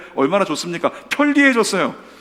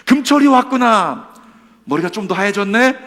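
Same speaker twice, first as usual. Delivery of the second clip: God's teasing greeting; 5 semitones higher, 8 dB louder than usual. Acted. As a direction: greet him like a delighted father. God voiced teasing the choir director. (하나님이 지휘자에게 반갑게 놀리듯 인사하는 목소리)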